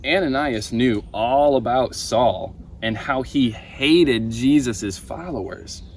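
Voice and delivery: plain reading voice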